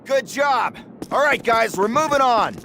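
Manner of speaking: high, urgent encouragement; keyed-up officer calling out orders; rallying the men. Acted. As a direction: push the squad on mid-fight. Captain urging men through assault.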